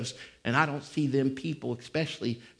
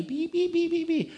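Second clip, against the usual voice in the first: very high squeaky "Beep, beep"; mouse squeak. Retold or imitated. Preacher mocking a timid mouse preacher, squeaking. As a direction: squeak like a little cartoon mouse. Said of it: "I ain't no mouse".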